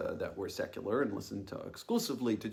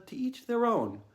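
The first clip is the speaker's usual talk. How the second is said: lifted, louder quoted motto; quoted family motto; 12 semitones higher, 7 dB louder than usual. Retold or imitated. Narrator quotes the family motto, lifted. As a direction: set the motto apart, higher and louder. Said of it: "motto was usually".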